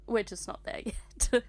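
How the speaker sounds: usual speaking voice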